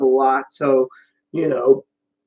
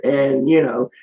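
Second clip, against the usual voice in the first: ordinary delivery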